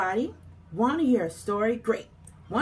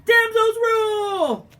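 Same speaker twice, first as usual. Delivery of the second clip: loud, high, slow victory shout; triumphant cheer; 9 semitones higher, 6 dB louder, slower than usual. Acted. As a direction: cheer it out proudly.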